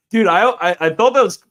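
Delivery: high, loud burst of excitement; excited. Real, not acted. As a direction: blurt it out, thrilled. Excited reaction to chat.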